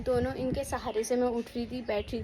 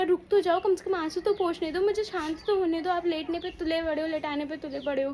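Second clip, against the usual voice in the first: higher, pleading mock yell at the nurses; retold panicked yell; 6 semitones higher than usual. New mother pleading with nurses before surgery, high panicked yell. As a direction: replay the tearful outburst, pitched up. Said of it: "करके मैं उन्हीं पे चिल्ला गई".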